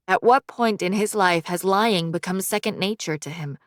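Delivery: ordinary delivery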